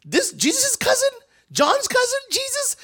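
very high, loud excited gossip; gossiping villager. Retold or imitated. Villagers passing around news about Jesus, very high and excited. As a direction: spread the news breathlessly. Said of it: "news went from".

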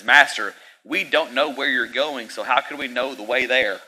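Master called out high and loud; urgent plea. Retold or imitated. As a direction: call out to the Master, pleading. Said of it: "Thomas says it like this".

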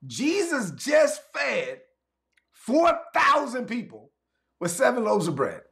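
very high, slow shouted emphasis; preaching shout. Real, not acted. Pastor reminding congregation of the miracle, slow high shout. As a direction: drag it out, incredulous.

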